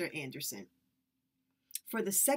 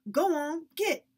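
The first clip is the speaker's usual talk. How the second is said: shooing; 8 semitones higher, 6 dB louder than usual. A narrator's reading. Man shoos children away.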